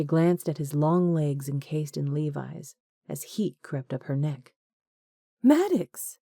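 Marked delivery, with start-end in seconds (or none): 5.4-6.2 a startled high squeak; squeaked name. A narrator's reading. Woman startled to see her ex, squeaking. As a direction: squeak his name in shock. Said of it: "she squeaked".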